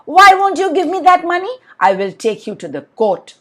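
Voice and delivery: hammed-up threat of taking him to court; angry businessman. Businessman threatens Amin with court, mock indignant.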